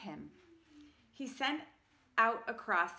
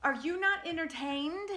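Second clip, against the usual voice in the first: gladiator boast; 8 semitones higher, 4 dB louder than usual. Acted.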